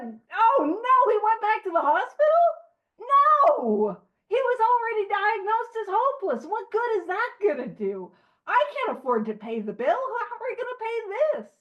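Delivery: high, loud mock outrage; pissed-off wife. Wife hearing her husband is back in hospital, mock-pissed. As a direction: play an exasperated wife's indignant rant. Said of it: "Lois was pissed".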